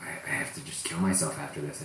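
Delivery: whispered, defeated; whispered despair. Retold or imitated. Comic after a dead room, whispered despair. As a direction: breathe it out like a beaten comic. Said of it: "I'm like".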